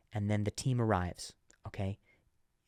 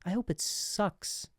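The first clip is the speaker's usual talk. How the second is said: spiteful wish; 8 semitones higher, slower than usual. Pretend. Imagined spiteful reply.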